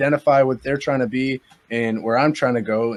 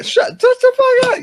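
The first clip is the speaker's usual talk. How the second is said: high, loud squeal of protest; mock-outraged yelp; 6 dB louder than usual. Real, not acted. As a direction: squawk it in playful disbelief. Playful disbelief at his age questioned.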